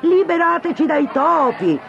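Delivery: high, loud crowd chant; crowd yelling. Acted. Angry townsfolk yell at the mayor, high and loud. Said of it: "urlavano al sindaco".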